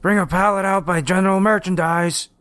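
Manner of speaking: high raspy call-out; raspy mimicry. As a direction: call out in a mocking, raspy put-on voice. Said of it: "He made his voice raspy".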